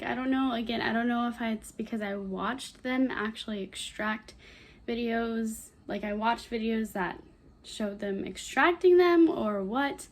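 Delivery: usual speaking voice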